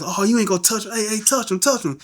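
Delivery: high-pitched crowd egging him on; hyping up. Retold or imitated. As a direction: imitate classmates goading a fight. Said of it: "people hyping up in class".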